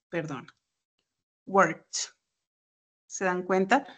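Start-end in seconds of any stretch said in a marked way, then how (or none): 1.5-2.1 worked said as one syllable, ending in T. Shown as a demonstration. Teacher models worked for students, T ending. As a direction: say worked with the -ed as a crisp T. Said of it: "se pronuncia".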